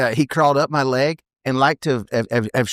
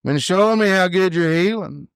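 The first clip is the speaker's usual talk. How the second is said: higher, slow drawl stretched at the end; Speedy impression; 7 semitones higher than usual. Partner phoning to offer a tryout, slow high drawl impersonation. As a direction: imitate the partner's drawn-out phone pitch. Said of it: "He's like".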